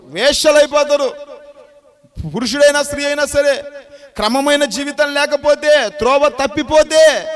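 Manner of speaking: loud, high pulpit warning; a preacher rising to a fiery pitch; preaching at full force. Acted. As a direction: drive the warning home to the congregation. Preacher raises voice to warn.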